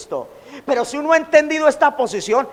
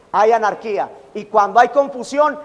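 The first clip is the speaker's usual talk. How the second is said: ordinary delivery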